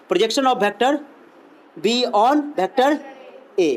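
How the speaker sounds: very slow, words spaced out; slow dictation. Shown as a demonstration. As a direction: dictate for students to copy. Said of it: "dictating the term again".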